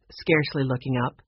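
ordinary delivery

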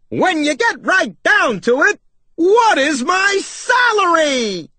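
high, brash shouted question; hollering cartoon salesman; pushy salesmouse. A narrator's reading. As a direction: cut in and stretch the last words out big. Pushy salesmouse cuts in again.

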